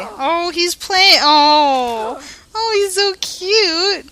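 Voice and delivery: high, drawn-out aww over a puppy; cooing. Real, not acted. Player watches the dog play, cooing loudly.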